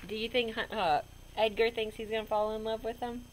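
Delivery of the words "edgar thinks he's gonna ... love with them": ordinary delivery, nothing marked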